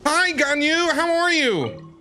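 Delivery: ordinary talk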